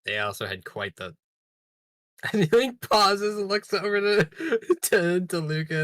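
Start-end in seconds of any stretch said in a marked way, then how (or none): 2.3-5.8 laughing. Real, not acted. Laughing while narrating his action.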